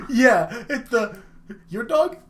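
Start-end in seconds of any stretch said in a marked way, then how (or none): 1.7-2.2 high-pitched mock Russian accent. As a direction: imitate the Russian ad guy, high voice. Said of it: "Because of his accent".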